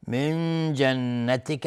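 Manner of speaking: slow من جنتک, with the n of من held nasally; ikhfa. Shown as a demonstration. Teacher demonstrating the rule, من held as a long nasal. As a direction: hum the hidden n of من into ج. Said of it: "نون ساکن بعد جیم اخفای حقیقی".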